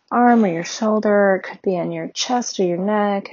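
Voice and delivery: ordinary talk, nothing marked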